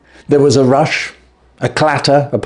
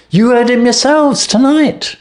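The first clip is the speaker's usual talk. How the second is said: desperate plea; 14 semitones higher than usual. A narrator's reading. Desperate thief pleads to Holmes.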